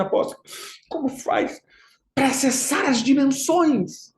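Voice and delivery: imitação leve de um fiel ansioso. Retold or imitated. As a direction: pergunte arrastado e mais agudo, como fiel seduzido. Imita fiel ansioso pedindo acesso. (fiel ansioso, imitado de leve)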